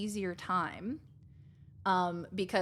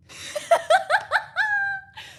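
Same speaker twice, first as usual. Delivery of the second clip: high squealing laugh; 8 dB louder than usual. Real, not acted. Laughs at her Kiwi impression.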